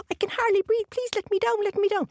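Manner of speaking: high squeaky leprechaun voice; tiny fairy man, high and pleading. A narrator's reading. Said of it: "he said".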